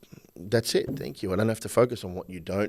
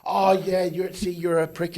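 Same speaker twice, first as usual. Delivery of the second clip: much higher, mocking jab; mock partner retort; 10 semitones higher, slower than usual. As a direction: sneer back at him in a put-on voice.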